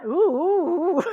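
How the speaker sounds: loud, high "ooh" breaking into laughter; playful hoot, then a big laugh. Real, not acted. Leader reacting to someone back on day one, hooting laughter.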